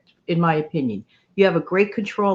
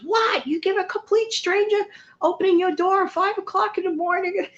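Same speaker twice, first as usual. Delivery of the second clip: high mock-incredulous husband voice; husband's disbelief; 13 semitones higher than usual. Husband scolding wife about stranger at door, high mock disbelief. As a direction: play the husband aghast. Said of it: "My husband goes".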